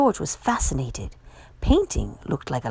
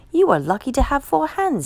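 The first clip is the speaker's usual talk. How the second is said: raised pitch for the kind cook; cook's voice; 8 semitones higher, 4 dB louder than usual. Acted. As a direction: voice the cook pitched well up, friendly. Cook speaks to George.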